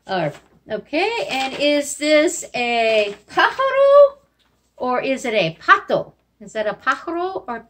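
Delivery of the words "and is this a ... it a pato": playful quizzing. Shown as a demonstration. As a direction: tease the kids with the choice. Teacher quizzes kids.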